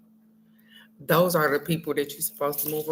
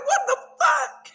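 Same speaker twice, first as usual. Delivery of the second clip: high mock shock; shocked reaction. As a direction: squeal the shocked question. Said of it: "I was like".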